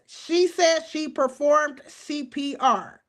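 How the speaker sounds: slow, drawn-out reading, word by word; deliberate re-reading. Real, not acted. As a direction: read it out slowly for emphasis. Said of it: "I'ma read it again".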